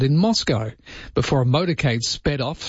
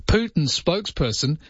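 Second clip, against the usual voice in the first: usual speaking voice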